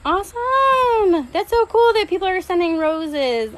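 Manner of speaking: high, drawn-out delighted cry; excited. Real, not acted. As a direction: squeal with real delight. Viewers sending roses on live.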